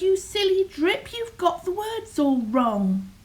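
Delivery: high-pitched scolding yell, quick to correct; mocking storybook yell; mild yell. A narrator's reading. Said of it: "the others yelled".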